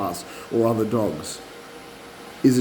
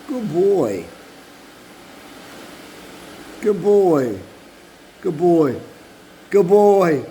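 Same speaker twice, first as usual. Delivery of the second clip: praising dog; 4 semitones higher, much slower than usual. Shown as a demonstration. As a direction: heap praise on the dog, more each time.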